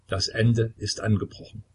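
ordinary delivery

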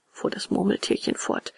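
plain reading voice

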